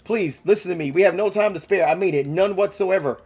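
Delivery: high, loud, frantic pleading; panicked planner pleading with the team. Acted. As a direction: beg them to stop before it's too late. Panicked plea to stop.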